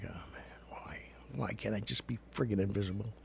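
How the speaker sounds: hushed, whispery mock lament; exasperated inner plea. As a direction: whisper it wearily, as if to yourself. Inner groan at nosy shopper.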